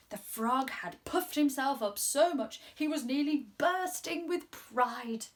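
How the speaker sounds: usual speaking voice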